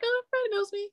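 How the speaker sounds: put-on high-pitched little girl voice; imitating young daughter. Daughter shrugging off her mom's fame, imitated child voice. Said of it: "she's just like".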